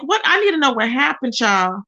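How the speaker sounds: normal talking voice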